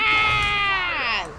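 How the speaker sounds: loud startled scream; scared horror game streamer. Real, not acted. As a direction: yelp in real panic. Boss attack lands on her.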